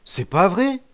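high, loud, frightened denial; panicked stammer. A narrator's reading. Accused boy before the band, frightened stammering denial. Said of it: "balbutia le bancal qui rougissait".

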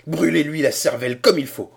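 loud, high demand; shouting. A narrator's reading. Cornelius shouts at Quaterkem to shoot. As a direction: bark the order for his death. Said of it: "cria Cornelius".

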